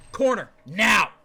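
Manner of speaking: stern scolding. Pretend. Streamer ordering Sora to the corner, loud stern.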